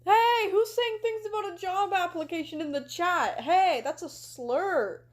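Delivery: high-pitched, playful scolding; mock outrage. Streamer scolding chat over a banned word, mock-outraged. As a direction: call them out in pretend shock.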